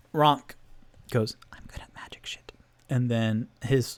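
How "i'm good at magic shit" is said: fast hushed whisper of a cocky mage. Acted. Rogue whispers to party in the dark, fast whisper. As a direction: breathe it out fast and secret. Rock speaking quietly in cave.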